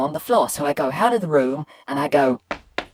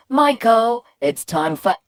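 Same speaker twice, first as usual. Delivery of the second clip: higher call on the name, then dropping away unfinished; mum's call cut off; 6 semitones higher, 4 dB louder, slower than usual. Acted. What the boy hears of mum's bedtime call, cut short. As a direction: mum voice stopping short. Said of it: "all I could hear was".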